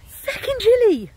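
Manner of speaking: stretched-out mock moan about the cold; exaggerated cold complaint. Real, not acted. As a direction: wail it like you're freezing.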